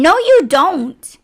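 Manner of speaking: slow, loud, drawn-out no you don't; slow loud scornful comeback; indignant retort. Retold or imitated. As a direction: snap back at her, slow and loud. Retort to support agent, mocking.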